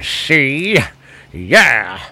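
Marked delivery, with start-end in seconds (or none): none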